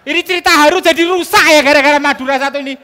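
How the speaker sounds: high, loud laughing tease; mock-scold a heckler, laughing. Real, not acted. Teasing the heckler from Madura, laughing loud and high.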